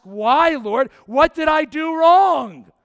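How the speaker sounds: loud, pleading, drawn-out question; anguished cry to God. Retold or imitated. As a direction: cry out to heaven, stretched and desperate. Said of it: "you ask".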